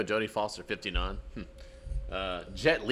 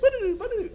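very high "doo-doo" riff imitation; sung guitar lick; 6 dB louder than usual. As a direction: squeal out a mock guitar riff.

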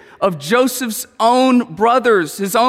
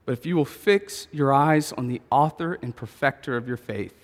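soft closing appeal; 6 semitones lower, 6 dB quieter than usual. Acted. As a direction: bring it down gently to a hushed invitation.

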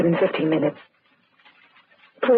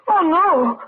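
shocked gasp; 8 semitones higher than usual. Acted. Finds the dead man.

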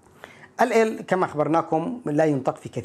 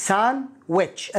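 "Sandwich" split as san, wich, no d; syllable by syllable; 4 semitones higher than usual. Shown as a demonstration. Teacher showing the silent d, "Sandwich" split in two. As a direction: break "Sandwich" slowly into two parts.

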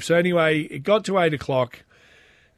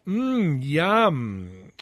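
mock yum. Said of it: "then he goes".

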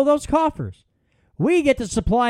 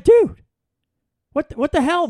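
sharp, much higher and louder "Dude", then an exasperated "what the hell"; a retold friend's annoyed blast of disbelief; irritated outburst; 7 semitones higher, 4 dB louder than usual. Friend calling back annoyed about the food saver, irritated outburst. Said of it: "he's a little irritated with me".

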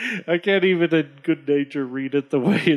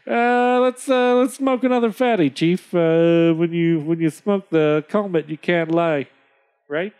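trader; 2 semitones higher than usual. Acted. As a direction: pitch it up to coax the chief. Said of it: "The trader says".

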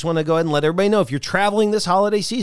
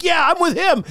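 mother agreeing; 12 semitones higher than usual. Retold or imitated. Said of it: "And Mom's like".

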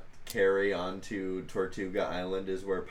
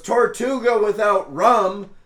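higher, louder pirate exclamation; pirate outburst; 9 semitones higher, 8 dB louder than usual. Acted. Cutlass McGee shouting over the sailor's story, loud and high pirate voice. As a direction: throw up his hands in a loud pirate voice. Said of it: "just goes".

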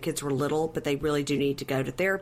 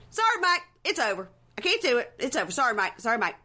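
high-pitched, singsong dismissal in short bursts; mock breakup; 5 semitones higher than usual. Woman breaking it off with Mike as they walk in, mock dismissive. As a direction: toss it off high, imitating a fed-up partner.

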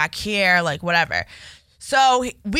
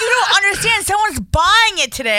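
loud, high exasperation; exasperated; 11 semitones higher, 5 dB louder than usual. Retold or imitated. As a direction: drive home the urgency. Boss yells about the buyer.

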